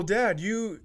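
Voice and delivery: normal talking voice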